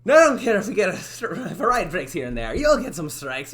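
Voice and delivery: loud, projected Italian-tinged boss voice; accented boss character. Acted. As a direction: bark the rule in the character voice. Testing boss's line in voice.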